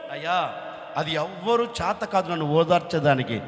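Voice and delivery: low, soft, inconsolable reply; subdued, hopeless old father; acted dejection. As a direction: drop right down into a grieving man's answer. Jacob answers, refusing all comfort.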